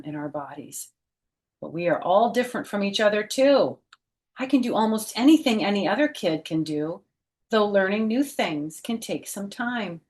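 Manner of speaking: brighter, lively read-aloud voice; storybook reading voice; reading to children. Acted. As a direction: read it out to a class of kids. Reads picture book aloud.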